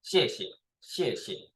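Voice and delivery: teacher's model "xie xie", second syllable dropped; falling, then neutral tone. Shown as a demonstration. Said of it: "lower tone, and also short".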